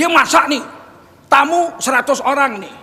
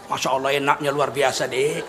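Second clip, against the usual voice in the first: low, soft flattering husband; pretend praise; 11 semitones lower, 8 dB quieter than usual. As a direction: praise your wife's cooking in a hushed, much lower voice. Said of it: "Untuk menyenangkan hati istri boleh bohong". (pura-pura memuji istri dengan suara rendah)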